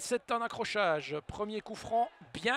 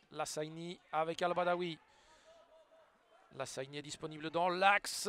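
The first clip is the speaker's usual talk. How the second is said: ordinary talk, nothing marked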